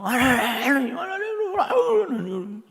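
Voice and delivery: high, drawn-out mock wailing; hammed-up sobbing plea, stretched and shrill with pitch way up; mock tearful prayer. Preacher mimics a young woman praying in tears, exaggerated high wailing. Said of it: "매일 기도할 때마다 울고 기도하는 거야".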